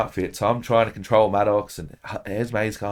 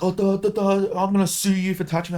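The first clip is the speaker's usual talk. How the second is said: much higher whiny mockery of the man; mocking whiny junkie; 10 semitones higher than usual. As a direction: sneer at him in a silly nagging voice. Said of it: "he's just like".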